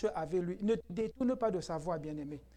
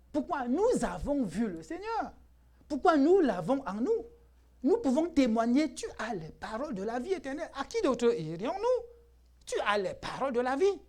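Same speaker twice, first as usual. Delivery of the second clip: high, loud, ringing sermon delivery; impassioned preacher's declaration; full-force preaching; 6 semitones higher, 6 dB louder than usual. Real, not acted. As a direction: proclaim it to the congregation with rising force.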